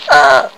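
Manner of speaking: high, acted yelp; cry of pain. A narrator's reading. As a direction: yelp as if poked in the ribs. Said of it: "Scott cried".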